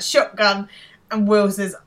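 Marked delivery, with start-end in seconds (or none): 0.0-0.7 short, louder mock call; retold shout. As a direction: call it out like claiming the seat. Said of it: "And then he shouts out".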